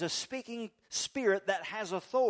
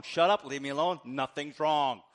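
mock snap; 3 semitones lower, 6 dB louder than usual. Wife snapping in acted quarrel.

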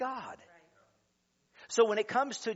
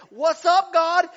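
higher, louder hey to God; mock cheerful call; 11 semitones higher, 14 dB louder, slower than usual. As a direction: shout up to God playfully.

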